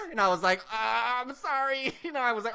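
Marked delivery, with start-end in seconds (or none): 0.7-1.9 high, drawn-out mock apology; re-enacted cowering sorry; sheepish apology. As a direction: apologize like a scolded kid. Apologizing to scolding aunt.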